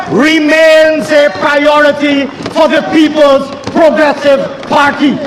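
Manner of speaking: usual speaking voice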